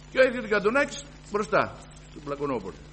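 usual speaking voice

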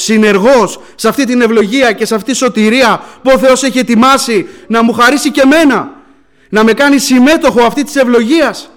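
impassioned preacher in full flow; full-force preaching. Real, not acted. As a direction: proclaim it to the whole congregation.

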